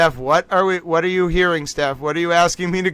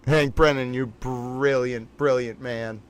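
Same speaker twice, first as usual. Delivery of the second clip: hushed admiration, savor each word; awed praise; 6 semitones lower, 7 dB quieter than usual. Real, not acted. Streamer praising Hank Brennan in hushed awe.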